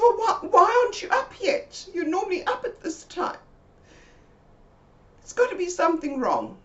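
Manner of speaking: high anxious questioning; worried pressing. Retold or imitated. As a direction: push her for an answer, worried. Pressing friend why.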